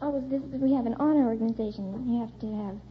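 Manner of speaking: ordinary delivery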